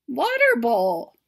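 usual speaking voice

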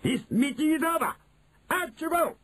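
high, imperious dismissal; commanding witch. Acted. Grand High Witch ends the meeting, high sharp command. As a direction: order them out in a high witchy pitch.

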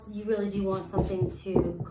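ordinary delivery

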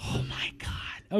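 whispered Oh my God, awed. Real, not acted. Driver whispering in awe about the car. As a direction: breathe it out, stunned. Reacting to Prius Prime.

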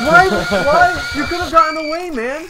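loud frustrated why; exasperated yelling. Real, not acted. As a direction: yell in disbelief. Reacting to friend's death.

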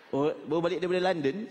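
ordinary delivery

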